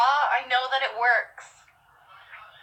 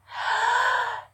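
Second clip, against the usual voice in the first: sharp gasp; 13 semitones higher than usual. Real, not acted. Hiccup fit.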